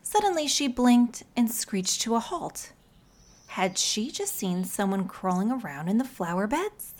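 plain reading voice

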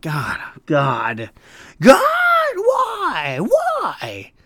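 high shouted cry to the heavens; a server crying out why after hearing she'll be back; mock anguished wail. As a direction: wail it like a tragic hero. Despair after customer returns.

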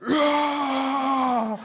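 high loud mock boy's roar; hammed-up boyish shout; boyish yell. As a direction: roar like a little boy playing. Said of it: "Now it's like".

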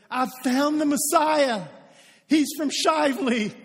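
loud, high, slowed proclamation; excited announcement. Acted. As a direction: proclaim it proudly like huge news, loud and high. Replays the excited announcement with hometown.

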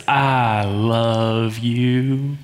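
slow, syrupy Southern drawl; oozing Southern-accented affection, very drawn out; great Southern accent. Acted. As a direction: stretch each word out in a smarmy Southern accent. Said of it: "says it in a great Southern accent".

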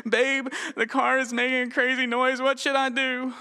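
dread in her voice. Retold or imitated. Wife calling her husband about the car noise, imitated dread. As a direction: play the anxious wife on the phone, voice up high. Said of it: "I could hear the dread in her voice".

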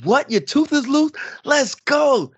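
high-pitched, excited hype-up; thrilled dad hyping up his child, pitch leaping up; picked-up energy. Retold or imitated. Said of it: "I just picked up my energy".

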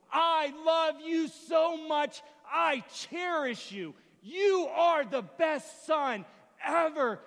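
slow, high, shouted declaration; full-force preacher, hammering each phrase; impassioned preaching. Real, not acted. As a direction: thunder it out to the congregation.

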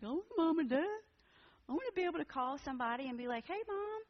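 high, whiny pretend crying; mock weeping. Retelling grief over absent parents, high mock-weepy wail. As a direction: wail it like a child missing its parents, hammed-up. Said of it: "with their mom and dad and weep".

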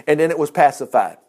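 ordinary delivery

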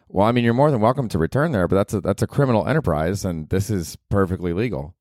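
usual speaking voice

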